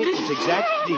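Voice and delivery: words breaking into giggles; laughing. Acted. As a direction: loses it again mid-phrase. Dad laughing again at time.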